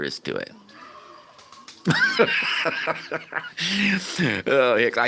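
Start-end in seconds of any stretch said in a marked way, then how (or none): 1.5-4.4 real laugh, much higher; high, bright burst of laughter. Real, not acted. Laughs at his own bit.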